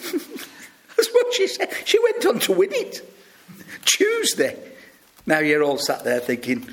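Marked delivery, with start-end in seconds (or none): 0.1-1.7 high breathless laughing words. Real, not acted. Preacher laughing to congregation at the quiz answer, high breathless laugh. 1.8-4.6 incredulous. Real, not acted. Preacher telling congregation the winner said Tuesday, high amazed disbelief. Incredulous retelling of quiz answer.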